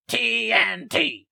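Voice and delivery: high, slow, exaggerated raspy screech in the nose; nasal raspy imitation. Shown as a demonstration. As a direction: caricature a rock singer, way up in the nose. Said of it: "up in their nose and make it really raspy".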